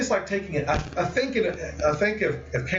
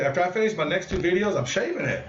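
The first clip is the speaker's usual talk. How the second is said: ordinary delivery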